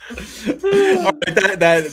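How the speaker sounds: talking through laughter; words breaking into laughter; laughing. Real, not acted. Laughing after the bit.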